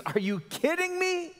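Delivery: high-pitched, disbelieving outburst; acted indignant protest, pitch shooting way up; mock incredulity. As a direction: blurt it out as an incredulous objector. Acting out a listener's indignant objection.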